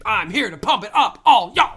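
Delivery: loud hype-man shout; heroic rallying shout. Acted. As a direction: pump up the crowd, wrestling style. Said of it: "gonna be like a wrestling promo".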